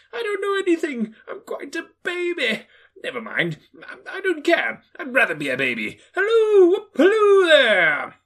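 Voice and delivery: very high, loud whoops; old man whooping like a baby; giddy shouting Scrooge. Acted. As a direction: shout with childish glee.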